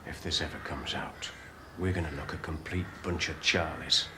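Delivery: hushed and urgent; whispering. Acted. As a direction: keep it low so nobody hears. Sergeant hushing a scandal.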